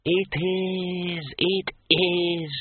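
high, thin, drawn-out ghost; snapping disembodied voice. A narrator's reading. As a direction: snap impatiently in an eerie high voice. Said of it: "snapped Graiture's disembodied voice".

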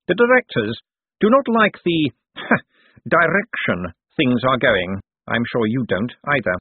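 high, leading businessman voice; insinuating Cosmo. Acted.

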